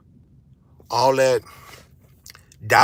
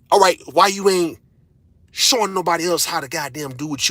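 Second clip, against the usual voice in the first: louder and higher, fed up and demanding; exasperated shout; 6 semitones higher, 6 dB louder than usual. Real, not acted. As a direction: throw the question at them hard. Ranting at lazy parents.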